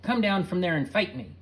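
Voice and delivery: loud high challenge; angry screaming. A narrator's reading. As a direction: taunt them to fight. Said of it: "he was screaming".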